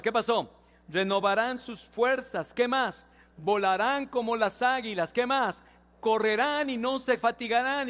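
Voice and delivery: high, loud, drawn-out proclamation; preaching verse recitation. Real, not acted. Pastor has the church read Isaías with him, rousing high proclamation. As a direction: lead a congregation in reciting, prompting them between phrases. Leads congregation reading the verse aloud.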